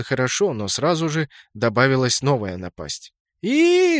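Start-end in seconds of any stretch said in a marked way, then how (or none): 3.4-4.0 sudden piercing high shriek; high squeal. Acted. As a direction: shriek in shock and outrage. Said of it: "завизжала эльфийка-целитель".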